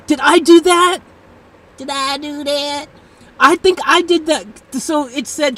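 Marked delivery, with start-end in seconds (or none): 0.1-1.0 high, loud surprise; startled question. Real, not acted. Streamer wonders if they broke the assistant, startled. Realises they may have caused it. 1.8-2.9 put-on comic voice. Streamer jokes about breaking the assistant, put-on comic voice.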